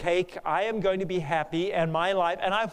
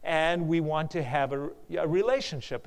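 ordinary talk

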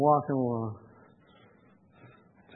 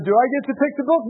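higher, louder retold question; hopeful request; 10 semitones higher, 7 dB louder, much faster than usual. Visitor asks man about picking the book, hopeful request. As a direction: ask permission in a raised voice.

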